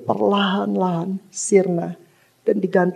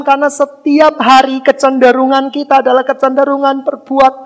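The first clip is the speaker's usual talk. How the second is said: louder, higher preaching voice, pressing on; full-force preaching; 6 semitones higher, 6 dB louder than usual. Acted. Preacher proclaims sin and salvation to the church, fervent and loud. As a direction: preach it out to the congregation with rising fervour.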